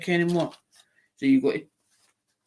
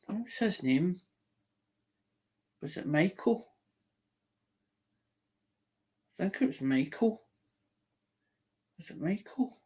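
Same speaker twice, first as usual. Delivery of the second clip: quiet muttering; 3 semitones higher, 9 dB quieter than usual. Real, not acted. Muttering to himself, recalling name.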